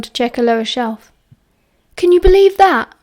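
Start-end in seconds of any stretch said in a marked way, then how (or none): 1.9-2.9 higher, louder, incredulous question; amused disbelief. A narrator's reading. James gossips to Remus about Wormtail, amazed and tickled. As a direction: marvel at the news, pitched up.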